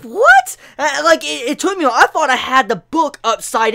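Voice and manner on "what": very high, loud, rising what; a squeaky disbelieving what; baffled what. Retold or imitated. Vlogger recalling the moment he saw the page, baffled squeak. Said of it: "I'm like".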